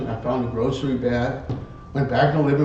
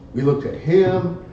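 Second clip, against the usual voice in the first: plain reading voice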